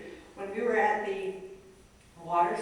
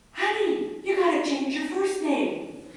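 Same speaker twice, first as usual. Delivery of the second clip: much higher, louder teasing husband; husband's joking advice; 10 semitones higher, 7 dB louder than usual. Retold or imitated. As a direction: tease her like a playful husband. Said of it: "he looks at me and he goes".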